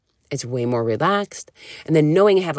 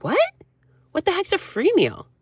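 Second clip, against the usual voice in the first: squeaky, much higher mock astonishment; baffled disbelief. Client hearing about free meals for the first time, shocked. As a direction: leap up in pitch like you've never heard of it. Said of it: "And she's like".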